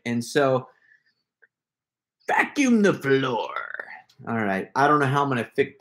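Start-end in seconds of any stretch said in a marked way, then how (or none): 2.3-3.5 slow, drawn-out character voice. Shown as a demonstration. Streamer trying a task in a character voice, slow character voice. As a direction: put on a voice and stretch the words out. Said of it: "in that voice, if I'm doing a character voice".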